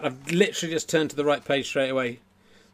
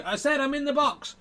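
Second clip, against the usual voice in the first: very high dummy voice; ventriloquist's dummy; 13 semitones higher than usual. Acted. Dummy repeats to the children from the box, very high voice. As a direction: insist in a much higher dummy pitch. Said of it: "turn around and go".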